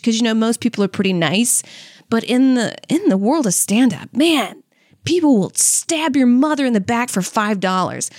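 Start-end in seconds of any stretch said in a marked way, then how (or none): none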